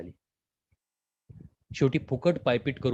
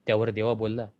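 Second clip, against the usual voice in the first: usual speaking voice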